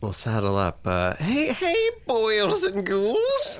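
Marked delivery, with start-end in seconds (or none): 1.1-3.6 high, slow spooky greeting; campy horror host greeting the kids. Acted.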